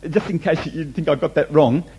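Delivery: normal talking voice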